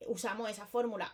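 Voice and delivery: normal talking voice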